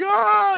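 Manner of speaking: high mock scream; imitated freak-out scream. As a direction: let out a short, high pretend cry of panic. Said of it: "he's freaking out at the end".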